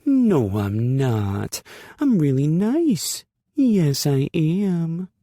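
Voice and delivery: put-on high, loud, slow baby talk to a dog; sugary dog talk. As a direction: squeal sweetly at the puppy. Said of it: "sugary sweet talking to a dog voice".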